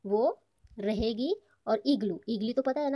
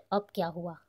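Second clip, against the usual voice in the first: usual speaking voice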